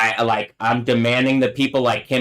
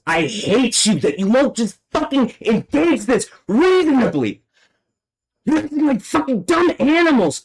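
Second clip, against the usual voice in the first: heated anger; 12 semitones higher than usual. Real, not acted. Ranting at whoever wronged him, furious and much higher. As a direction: pitch climbing much higher in real fury. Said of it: "I'm, like, heated, clearly".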